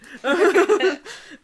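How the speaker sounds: a high, loud burst of real laughter. Real, not acted. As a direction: laugh at your own warning. Laughs after warning about the author.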